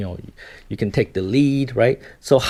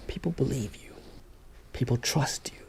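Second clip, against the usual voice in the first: near-whisper, confiding; very soft, breath-only murmur; hushed lower voice; 15 dB quieter than usual. Shown as a demonstration. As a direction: drop almost to a whisper to win trust. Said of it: "in a lower voice".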